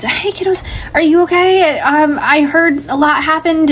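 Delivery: pitched well up, eager and kind; a bright, eager dad hurrying to check on his kids; high cheery dad voice. Acted. Dad greeting his daughters home from the police, high and eager.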